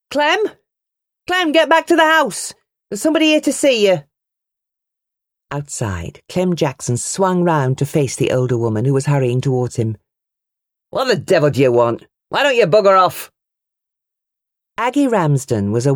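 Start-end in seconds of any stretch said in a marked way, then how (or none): 0.1-4.0 a much higher call, fast at the end. A narrator's reading. Mother calling her brother away from the barn, calling out. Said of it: "her mammy's voice called out". 10.9-13.3 rough and louder, rising in anger; angry retort. A narrator's reading. Uncle snapping at his sister, angry.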